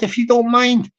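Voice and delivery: ordinary talk, nothing marked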